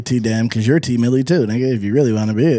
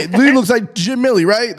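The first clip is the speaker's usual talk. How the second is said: hypothetical caption; 8 semitones higher than usual. Pretend. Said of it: "I say".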